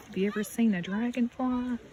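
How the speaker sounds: ordinary delivery, nothing marked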